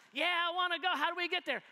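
eager friend. Retold or imitated. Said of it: "So if I was like".

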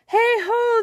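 high, loud answering hail; called reply. A narrator's reading. Fairy sailors calling back to Birdling, a high loud shout. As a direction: shout back across the deck. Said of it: "they replied".

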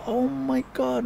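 high, drawn-out happy amazement; high-pitched retold astonishment; delighted surprise. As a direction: act out bumping into an old mentor. Surprised to meet his mentor.

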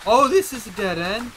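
being chased. Real, not acted. Fleeing monster.

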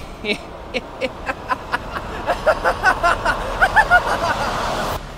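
evil laugh. Acted. Villain cackling at his own birthday. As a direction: cackle with wicked delight.

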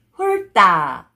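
훑다 run together as 훌따, tense tt; read together. Shown as a demonstration. Teacher shows how it reads together, linked pronunciation. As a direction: join 훑다 with a hard 따. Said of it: "you have to read it this sound".

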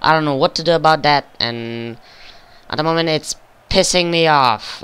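ordinary delivery

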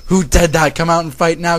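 angry, high shouting; angry scream. A narrator's reading. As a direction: shout a furious challenge at the attacker. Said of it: "Kagami screamed".

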